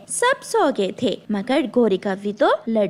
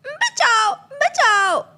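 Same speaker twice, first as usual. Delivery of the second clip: much higher, loud screams of help; panicked cry for help; 10 semitones higher, 3 dB louder, much slower than usual. Acted. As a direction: scream in terror as the fire spreads. Crow's wing catches fire. (घबराकर ज़ोर से चीखना)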